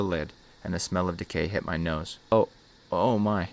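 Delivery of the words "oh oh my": mild narrated gag; an understated revolted reaction; lightly acted choking disgust; gagging. A narrator's reading. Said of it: "I gagged".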